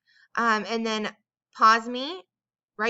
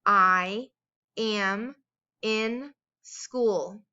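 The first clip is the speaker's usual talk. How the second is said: slow with pauses between words, first word louder; word-by-word dictation; 3 dB louder, much slower than usual. Shown as a demonstration. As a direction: separate each word. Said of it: "Say it with me".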